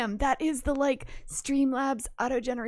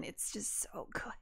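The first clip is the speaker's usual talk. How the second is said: soft unvoiced whisper of delight; hushed whisper of admiration; whispered gush; 4 semitones lower, 15 dB quieter than usual. Real, not acted. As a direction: breathe it out in awe. Gushing over gear.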